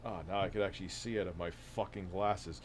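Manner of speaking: ordinary talk, nothing marked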